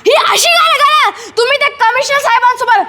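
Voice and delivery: high-pitched exasperated outburst; angry scolding. Acted. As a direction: burst in and tell the children off. Uncle angrily stops the song.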